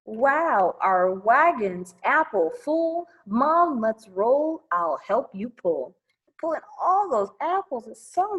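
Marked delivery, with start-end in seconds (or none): none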